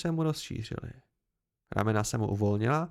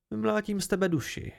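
mildly serious threat, pitched up; 8 semitones higher than usual. A narrator's reading. Bast answering the chronicler who may hit him, serious. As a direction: threaten in earnest without raising the volume. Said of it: "odvětil Bast vážně".

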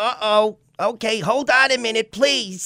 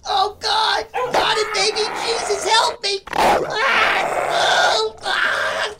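terrified horror victim; 7 semitones higher, slower than usual. Acted. Attacked by the cat Twinkles.

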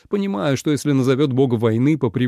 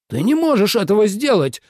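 high, raised-voice outburst; flaring up; 10 semitones higher, 4 dB louder than usual. A narrator's reading. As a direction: explode in protest, pitch jumping. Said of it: "взвился Минлу". (вспылив, высоким голосом)

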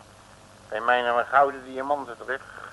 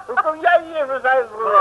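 laughing; 11 semitones higher, 5 dB louder than usual. Acted. Laughing reunion with Longveer.

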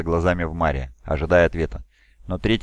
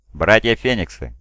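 ringing, higher, louder proclamation; bright soaring fiery voice; 4 semitones higher, 6 dB louder than usual. A narrator's reading. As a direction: hail the hall like a fiery lord. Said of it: "воскликнул Фулгрим".

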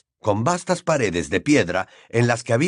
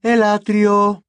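higher, louder, slow cold announcer; icy female voice; 10 semitones higher, 6 dB louder, much slower than usual. Acted. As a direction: announce it coldly and slowly. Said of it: "dijo la gélida voz femenina".

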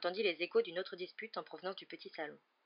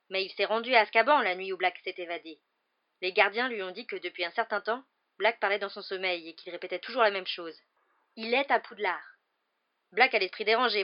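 urgent, fairly forceful explanation; insistent arguing husband; 8 dB louder than usual. A narrator's reading. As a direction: drive home the danger.

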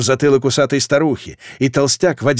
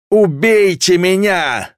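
high, drawn-out desperate shriek; monstrous scream; 7 semitones higher, 5 dB louder, much slower than usual. A narrator's reading. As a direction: scream it out, begging to be killed. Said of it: "крик был чудовищным. Люди так не кричат".

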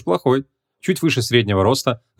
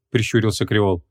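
ordinary delivery, nothing marked

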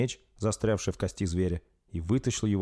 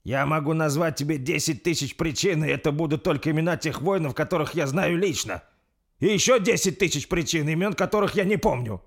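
raised, higher indignant voice; heated retort; 8 semitones higher, 5 dB louder than usual. Acted. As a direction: fire back a forceful accusation. Лорд гневно отвечает правителю. (с жаром, возмущённо)

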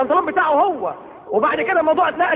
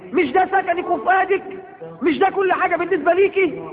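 pitched up, insistent reproach; raised preaching voice; 5 semitones higher than usual. Real, not acted. Preacher rebuking wives about their husband, raised and insistent. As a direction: drive each question home higher.

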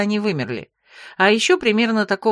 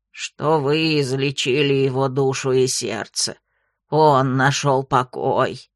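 low, slow croaking toad; gurgling frog voice; 6 semitones lower, slower than usual. A narrator's reading. Frog character voice.

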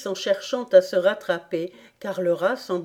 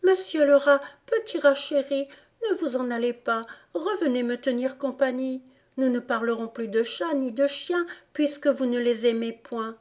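put-on higher, coaxing little-girl voice; sweet pleading; 6 semitones higher than usual. A narrator's reading. Alice begs the fleeing rat to return, sweetly pleading. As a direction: coax gently, pitched up. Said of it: "lui dit mille choses douces".

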